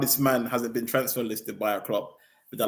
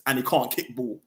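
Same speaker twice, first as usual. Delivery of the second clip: ordinary talk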